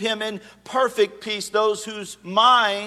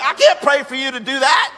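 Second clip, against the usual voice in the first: louder and higher, laughing through it; half laugh, half disbelief; laughing refusal; 5 semitones higher, 6 dB louder than usual. Retold or imitated. Pastor retelling a request to pray for a fugitive, laughing. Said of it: "And I'm like".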